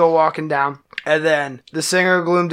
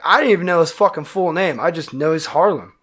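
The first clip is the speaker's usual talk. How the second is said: usual speaking voice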